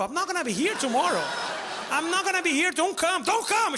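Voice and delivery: panicked high protest. Retold or imitated. Panicked retold reply.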